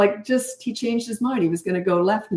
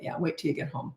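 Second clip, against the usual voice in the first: much softer, low-key threat; ominous warning; 3 semitones lower, 11 dB quieter than usual. Acted. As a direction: let it hang quietly like a promise. Ominous aside to misbehaving kid.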